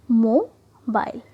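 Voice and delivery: Mobile said very slowly as मो-बाइल; slow model word. Shown as a demonstration. Teacher modelling a vocabulary word for students, slowly. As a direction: draw mobile out slowly for learners. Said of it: "जब हमने break किया".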